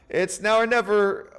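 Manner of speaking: high, loud burst of excitement; excited shout. Real, not acted. Streamer watching his scheme pop, shouting with excitement. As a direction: yell as the scheme finally fires.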